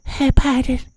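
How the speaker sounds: very high, tiny cooing voice; mock coddling media. Media propping up the president on a pillow, high mock coo. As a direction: coax him in a small high voice.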